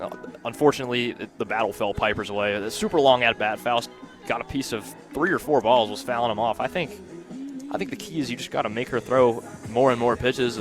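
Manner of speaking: usual speaking voice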